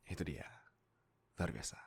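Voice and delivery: ordinary delivery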